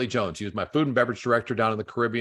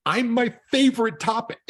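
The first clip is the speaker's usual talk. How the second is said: much higher, hammed-up vain voice; mock self-absorbed; 12 semitones higher, 4 dB louder than usual. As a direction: play the vain guy. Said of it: "And you know what?".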